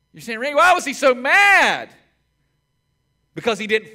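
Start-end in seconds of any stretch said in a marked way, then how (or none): 0.4-1.9 mock objector. Voicing a listener's objection.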